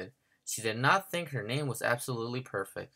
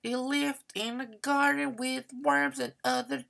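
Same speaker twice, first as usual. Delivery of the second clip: smug know-it-all; 14 semitones higher than usual. Acted. Victoria showing off at nap time, smug and high.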